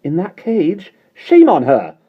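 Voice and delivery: high, loud indignant outburst; be shocked, then furious on the bird's behalf; outraged anger. A narrator's reading. Mary Poppins outraged at the lark's two years caged. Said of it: "her face flushing with anger".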